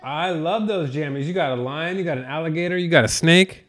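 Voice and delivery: gentle sing-song daddy talk. Real, not acted. Father admiring son's pajamas.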